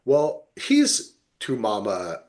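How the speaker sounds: usual speaking voice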